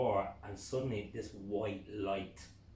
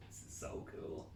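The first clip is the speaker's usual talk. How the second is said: hushed, wondering murmur; awed hush; 9 dB quieter, slower than usual. Retold or imitated. Man at the window, awed, hushed. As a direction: breathe it in quiet wonder. Retelling awe at binoculars.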